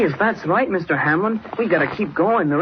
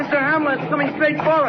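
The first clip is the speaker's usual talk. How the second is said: high, frantic cry; a boy watching death come at him; pitched-up terror; terrified; 8 semitones higher than usual. Acted. Slide coming straight at them.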